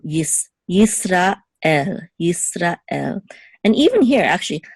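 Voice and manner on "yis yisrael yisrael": Yisrael parted before "el"; a short stop between Yisra and el in Yisrael; separated sounds. Shown as a demonstration. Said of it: "And here too".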